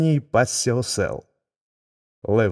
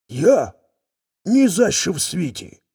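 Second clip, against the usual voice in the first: lion cries out; 7 semitones higher, 3 dB louder than usual. Acted. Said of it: "скрикнув лев".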